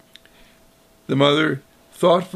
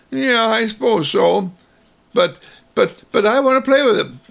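high, louder, fast whine; whining child; 8 semitones higher, 4 dB louder than usual. Acted. Child giving in then whining he wants to play, high and loud. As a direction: grudgingly agree then whine.

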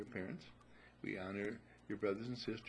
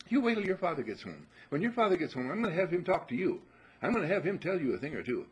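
much higher and louder scolding threat; shrill-free loud scolding, pitched up high; mother yelling a threat; 9 semitones higher, 11 dB louder than usual. Acted. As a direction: yell it at the kid like an exasperated mom.

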